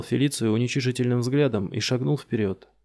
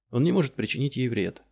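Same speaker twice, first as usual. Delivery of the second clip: ordinary delivery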